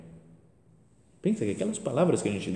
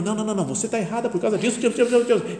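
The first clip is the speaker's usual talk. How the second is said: high, fast, hammed-up "no, no, no"; imitating a fussy priest cutting her off; mock rapid objection; 11 semitones higher, 6 dB louder, much faster than usual. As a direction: rattle off the objections in a mocking high voice. Woman retells priest's rapid objections.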